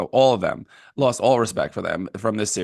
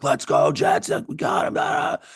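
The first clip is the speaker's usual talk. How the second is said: retold stadium yell; 5 semitones higher than usual. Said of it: "I am screaming after that game and yelling".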